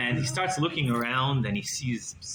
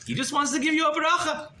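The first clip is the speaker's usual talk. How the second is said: high, louder, urgently persuasive; eager coaxing; 14 semitones higher, 3 dB louder than usual. Acted. Assistant eagerly coaxing Tadris to come, pitched up and louder. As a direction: sell it like it's a great deal.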